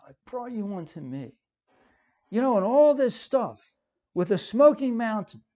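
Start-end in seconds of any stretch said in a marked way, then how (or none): 0.1-1.3 much softer "brought you unto me"; softly repeated quote. Acted. Preacher repeating God's personal words to listeners, softly. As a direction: drop the volume, letting "unto me" settle.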